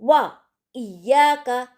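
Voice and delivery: वा इय्याका slow with a clean w; slow, clear वा इय्याका. Shown as a demonstration. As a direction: give वा its full w, then इय्याका. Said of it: "साफ आवाज़ में".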